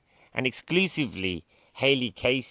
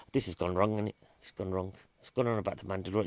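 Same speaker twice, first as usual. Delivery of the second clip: normal talking voice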